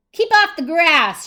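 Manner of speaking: high, loud, drawn-out shout; Hank. Acted. Cactus ordering a cowboy away, shouted. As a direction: shout it as a stern warning. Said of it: "shouted Hank".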